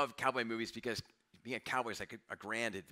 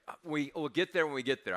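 exasperated dad; 5 semitones higher, 6 dB louder than usual. Retold or imitated. Dad answering impatient kids.

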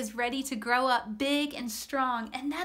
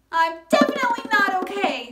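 higher, louder, fast and fretful; upset; 6 semitones higher, 7 dB louder, faster than usual. Acted. Worried shark answering her friend, fast and fretful. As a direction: blurt out how upset you are. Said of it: "Sakina answered".

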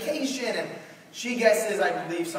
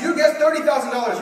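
loud, high, fast host read; host announcing the guess, loud and quick; announcer; 8 semitones higher, 8 dB louder, faster than usual. Retold or imitated. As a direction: rattle it off like a game show host. Host reading Alex's guess.

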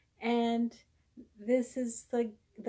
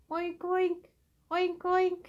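oinking pig; 6 semitones higher than usual. Acted. Imitating the pig for a toddler.

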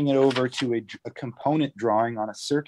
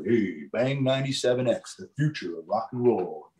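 big radio voice. Shown as a demonstration. Tour guide demonstrating the mic's proximity effect, big radio voice. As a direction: put on a big radio DJ voice close to the mic. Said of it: "you can get a really big radio voice".

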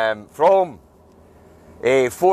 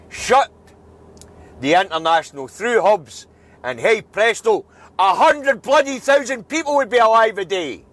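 angry rant; 7 semitones higher than usual. Real, not acted. Angry rant about covid deaths.

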